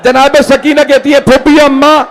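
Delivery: put-on a touch of a child's lament; high-pitched mourning recitation. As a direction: plead it like a grieving girl. Child Sakina's words in the lament.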